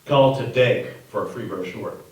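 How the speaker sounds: parroting the ad slogan at a slow crawl; quoting an ad. Retold or imitated. Narrator quotes the tired slogan in a slow mock announcer voice. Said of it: "how many times have we heard that phrase".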